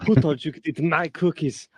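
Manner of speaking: put-on Austrian accent, lightly done; a hint of Schwarzenegger; Arnold Schwarzenegger impression. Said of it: "Arnold Schwarzenegger ... when he says".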